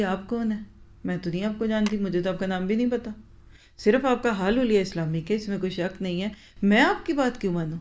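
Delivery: normal talking voice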